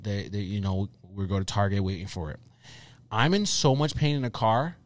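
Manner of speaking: ordinary delivery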